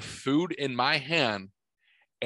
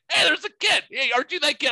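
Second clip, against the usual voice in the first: much higher, louder mock female call; teasing ladies' voice; 13 semitones higher, 5 dB louder, faster than usual. Hotel ladies spotting the boy again, high mock voice. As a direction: call out to him like amused women. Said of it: "They're like".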